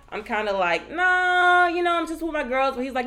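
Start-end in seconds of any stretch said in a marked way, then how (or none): 0.9-2.7 high, dismissive nah; brushing off the guy who wants to come over. Retold or imitated. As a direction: wave him off with a raised voice.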